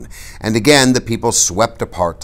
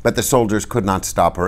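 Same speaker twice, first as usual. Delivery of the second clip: ordinary delivery, nothing marked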